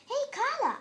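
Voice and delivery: high and loud call after her; shouted. A narrator's reading. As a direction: call out to her as she walks off. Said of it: "I shouted".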